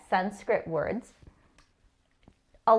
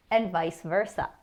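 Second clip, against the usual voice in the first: normal talking voice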